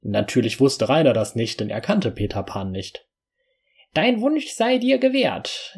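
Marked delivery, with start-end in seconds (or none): 3.9-5.8 high, slow, squeaky fairy voice; a tiny fairy granting a wish. Acted. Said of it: "mit piepsiger Stimme".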